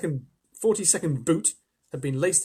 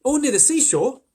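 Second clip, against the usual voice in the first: put-on higher, louder eager child; eagerly; 11 semitones higher, 9 dB louder than usual. A narrator's reading. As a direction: burst in excitedly as a boy. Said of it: "said James eagerly".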